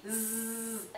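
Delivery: quiet zzz like a little bee; bee buzz. Shown as a demonstration. Teacher to students: soft bee buzz for the z. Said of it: "como una abejita".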